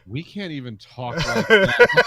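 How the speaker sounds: booming, slow disbelief; shocked loud cops. Retold or imitated. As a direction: blurt it out, appalled. Stunned cops react to racist speech.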